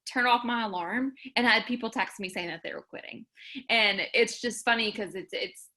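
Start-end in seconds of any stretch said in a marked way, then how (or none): none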